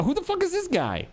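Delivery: high, incredulous yelp; mock outraged cry; exasperated outburst. Real, not acted. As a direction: blurt it in disbelief. Ranting about unknown candidate.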